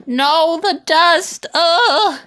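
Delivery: hammed-up dread at laundry dust; mock despair. Real, not acted. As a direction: complain as if the dust is doom. Dramatic dust complaint.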